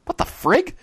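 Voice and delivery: high-pitched, rising bewildered question; baffled disbelief. Real, not acted. Host bewildered by the match description, high disbelief. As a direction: let it squeak upward in disbelief.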